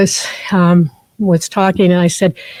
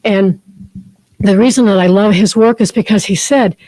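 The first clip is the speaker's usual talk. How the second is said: ordinary talk, nothing marked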